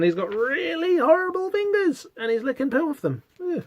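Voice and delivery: high, squealed revulsion; disgusted. Real, not acted. Disgusted by the horrible fingers.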